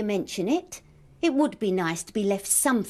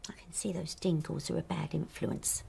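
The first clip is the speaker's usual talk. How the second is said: muttered under breath; hushed, bitter aside; whispered aside; 7 semitones lower, 11 dB quieter than usual. Acted. Muttered aside during quarrel.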